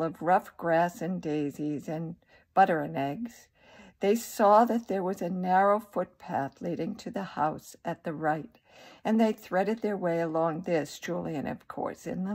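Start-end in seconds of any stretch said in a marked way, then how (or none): none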